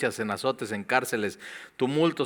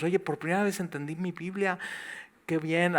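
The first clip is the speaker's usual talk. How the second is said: higher, softer mock wonder; amazed newcomer; 6 semitones higher, 5 dB quieter than usual. Newcomer amazed at understanding the Bible, soft mock wonder. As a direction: breathe it like a revelation.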